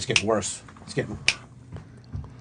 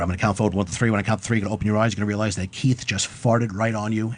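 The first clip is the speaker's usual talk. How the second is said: ordinary talk, nothing marked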